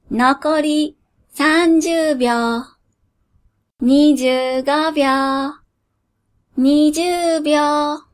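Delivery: slow, cold genie counting down; cold countdown. Acted. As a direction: count down slowly, without mercy. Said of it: "魔人が冷たくカウントダウンする".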